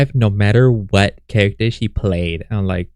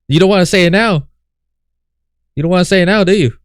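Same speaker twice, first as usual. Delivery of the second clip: needling, higher-pitched taunt; 7 semitones higher, faster than usual. Real, not acted. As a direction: goad her with a raised pitch. Goading the woman in the clip.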